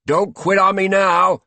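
loud, high urging from the coach; coach's shout. A narrator's reading. Coach urging on the master mid-exercise, loud shout. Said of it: "said the coach".

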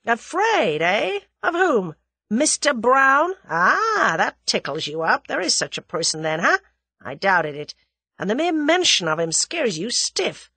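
loud, high questions settling into knowing jabs; American gunman, mockingly probing; taunting American. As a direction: taunt him as you find his weak spot.

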